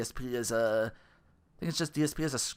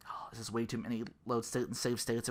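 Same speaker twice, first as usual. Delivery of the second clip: hushed, breathy inner voice; soft whispered aside; whispered thought; 4 semitones lower, 9 dB quieter than usual. Retold or imitated. Quoting own past thought.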